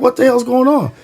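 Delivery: high, startled cry of alarm. Retold or imitated. Boys seeing their friend hit the ground, startled alarm. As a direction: blurt it out in shock.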